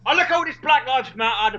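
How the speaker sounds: ordinary delivery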